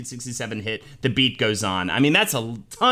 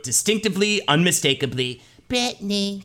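[name] voice; 6 semitones higher than usual. Retold or imitated. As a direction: lift it into a poppy [name] voice. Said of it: "to, quote".

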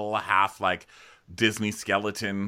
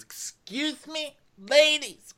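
Ramona; 11 semitones higher than usual. Retold or imitated. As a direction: caricature of a hostess addressing the table. Acting Ramona addressing the table.

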